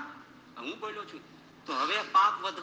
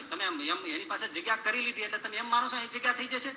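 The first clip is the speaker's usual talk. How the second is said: normal talking voice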